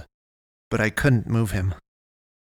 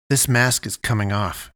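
usual speaking voice

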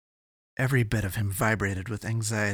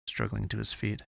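plain reading voice

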